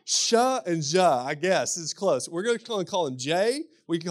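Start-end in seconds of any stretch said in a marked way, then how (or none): 0.0-1.2 "Sha" and "Ja" spelled out slowly and louder, Kentucky accent; slow syllable demo. Shown as a demonstration. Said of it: "I have no way to pronounce these any different".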